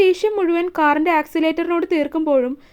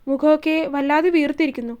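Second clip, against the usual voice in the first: plain reading voice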